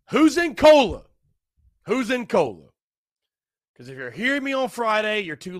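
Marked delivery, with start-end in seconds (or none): 0.1-2.7 high, loud shout to the crowd; hollering out to the listeners in Cola; calling out. Real, not acted. Host calling out to listeners across town, loud and high.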